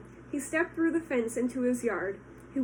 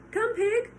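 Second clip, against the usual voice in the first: loud, high call to the pig; farmer calling; 10 semitones higher, 5 dB louder than usual. Acted. Mister Zuckerman calling the pig, loud.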